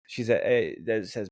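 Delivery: usual speaking voice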